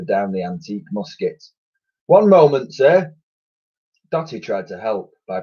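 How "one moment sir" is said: strained soldier. A narrator's reading. Titch struggling.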